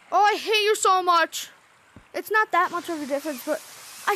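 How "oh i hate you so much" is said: loud, higher cry of exasperation; frustrated shout. Real, not acted. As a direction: yell it at the game in frustration. Screen suddenly too bright.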